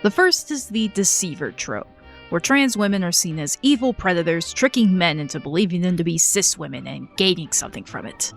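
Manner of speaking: ordinary talk, nothing marked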